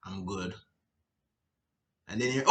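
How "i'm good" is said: flat reply. Retold or imitated. Client answers flatly.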